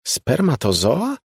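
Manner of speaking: high, slow questioning spermatozoa, rising at the end; slow puzzled echo; disbelieving echo. A narrator's reading. Molly echoes in disbelief.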